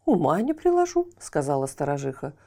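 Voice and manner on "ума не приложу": high, puzzled wife voice; wife answering her husband; watchman's wife. Acted. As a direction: shrug it off, high-pitched.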